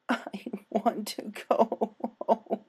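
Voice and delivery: a mild sob, drawn out; sobbing. Acted. Dash begs Pinkie, slow and tearful. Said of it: "she openly sobbed".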